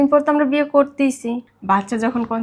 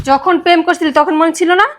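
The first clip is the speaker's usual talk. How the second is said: loud, high, angry challenge; furious accusation; 6 semitones higher, 6 dB louder than usual. Acted. Betrayed girlfriend confronting boyfriend about their romance, furious. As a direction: hurl the accusation at him.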